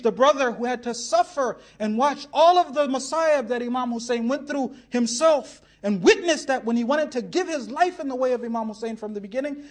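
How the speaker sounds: ordinary talk, nothing marked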